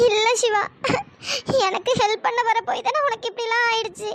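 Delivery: sobbing between phrases; crying. Acted. Girl blames herself for Siva's injury, crying. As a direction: talk through small sobs, blaming yourself. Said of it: "நீ எதுக்குடி அழுகுற?".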